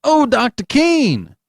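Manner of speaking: high, loud, slow drawn-out call. Acted. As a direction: call him urgently from afar. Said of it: "he called".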